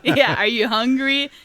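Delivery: loud, laughing jab from a tactless aunt; rude aunt. Retold or imitated. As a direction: tease loudly while laughing. Aunt voiced mocking the kid.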